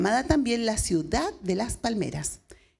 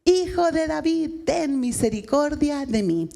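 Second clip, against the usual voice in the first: grito del ciego; 5 semitones higher than usual. Retold or imitated. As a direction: call out desperately across the crowd. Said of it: "él gritaba". (grito desesperado del ciego pidiendo misericordia)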